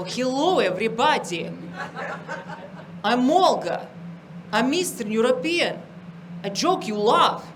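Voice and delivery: hammed-up Eastern European accent, in short halting phrases. Comic showing what people expect from Olga, thick Eastern European accent. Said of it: "they expect me to go".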